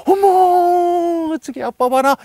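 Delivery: very high, stretched "어머" in awe; a wife pointing out dad to the kids, awed and high; admiring wife. Retold or imitated. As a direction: gasp in admiration at dad coming home. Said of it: "이렇게 인정해 주는 거잖아요".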